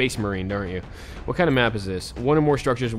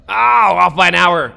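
loud, higher yell of disbelief; angry shout; 8 semitones higher, 14 dB louder than usual. Real, not acted. Streamer yelling at the timer being off, angry shout. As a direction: shout it at the screen, fed up. Missed again, real anger.

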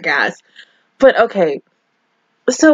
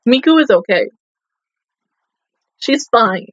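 ordinary delivery

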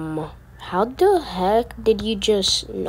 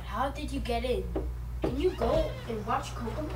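quiet, away from the mic, baffled; half-whispered disbelief at the intruder; hushed exasperation; 14 dB quieter than usual. Real, not acted. Girl quietly asking her brother how he got back in.

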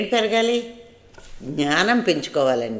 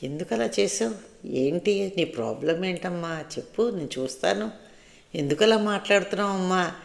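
much softer, kind questioning; gentle soft asking; 7 dB quieter than usual. Shown as a demonstration. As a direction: coax gently, like soothing a child. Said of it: "నవ్వుతూ మెల్లిగా మాట్లాడాం".

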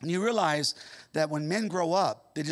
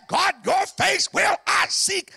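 loud, high, slow cry to God; David's answer roared out slowly; shouted psalm line; 13 semitones higher, 7 dB louder, slower than usual. Acted. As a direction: cry it out with everything.

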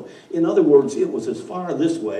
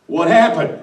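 louder, higher menacing demand; the devil doing his best villain growl; Darth Vader devil; 6 semitones higher, 10 dB louder than usual. Acted. Devil demands answer from the wind, menacing villain voice. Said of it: "I'm sure the devil can".

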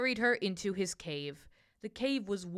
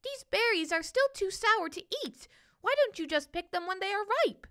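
high, fussy little bird voice, a bit faster; high chirpy bird complaining about sour berries; bird making a face; 11 semitones higher than usual. A narrator's reading. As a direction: scold gently with a sour face. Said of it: "making a face".

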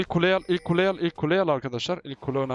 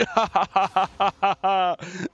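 triumphant laughing fit; gloating laugh; 5 semitones higher than usual. Real, not acted. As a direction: laugh long and gleefully.

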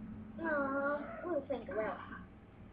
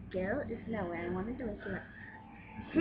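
ordinary delivery

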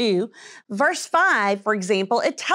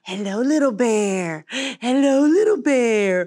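tender cooing; 4 semitones lower, slower than usual. Acted. Greeting the bear tenderly.

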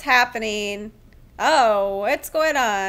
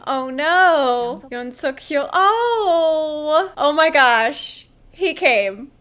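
usual speaking voice

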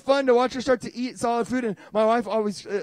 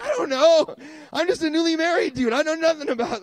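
put-on flustered young husband; 7 semitones higher than usual. Newly married dude admitting ignorance, high and flustered. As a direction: babble it, out of your depth.

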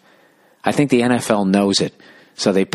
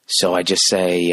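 ordinary delivery

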